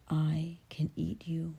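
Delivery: slow, hushed monster threat; mean monster. Acted. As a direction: threaten softly and slowly as a monster. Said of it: "this monster was the meanest".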